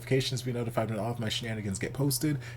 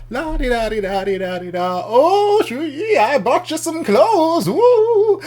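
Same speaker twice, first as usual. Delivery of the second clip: high, loud singsong flamboyance; whimsical Daddy entrance; 13 dB louder, slower than usual. Retold or imitated. Daddy rolls in bearing gifts for Shuyi, whimsical. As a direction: trill it grandly, hammed-up and whimsical. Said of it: "whimsical in French".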